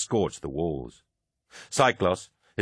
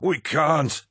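high, raised voice of refusal; bellowed protest; 5 semitones higher, slower than usual. A narrator's reading. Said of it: "bellowed the War Master".

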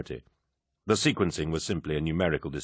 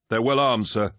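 warning; 6 semitones higher, 4 dB louder than usual. A narrator's reading. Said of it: "the officer warned".

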